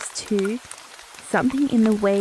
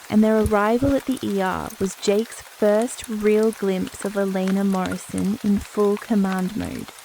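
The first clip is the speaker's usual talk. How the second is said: plain reading voice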